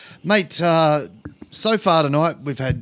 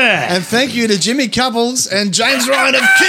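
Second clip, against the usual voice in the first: raised, loud show thank-you; rousing thanks; 5 semitones higher, 4 dB louder than usual. Real, not acted. Host thanking musical guests over cheers, loud rousing send-off. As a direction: shout out the guests' names to applause.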